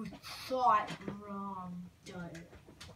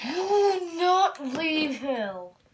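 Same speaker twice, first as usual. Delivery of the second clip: slow, loud threatening boom; slow doom-laden decree; menacing monster; 5 dB louder, slower than usual. Acted. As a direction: threaten them slowly from above. Monster forbids leaving.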